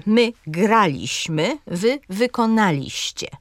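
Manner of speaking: GRA-liś-my, wy-ko-NA-liś-cie; third-last syllable stressed in "graliśmy" and "wykonaliście"; antepenultimate stress. Shown as a demonstration. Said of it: "Na trzecią sylabę od końca akcent pada".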